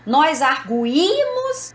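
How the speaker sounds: "arguímos" slow, with a long stressed í. Shown as a demonstration. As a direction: draw out the í in "arguímos". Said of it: "porque esse I está fora do radical".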